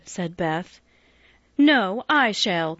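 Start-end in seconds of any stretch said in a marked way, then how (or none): 1.6-2.8 cried out. A narrator's reading. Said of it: "cried Amy".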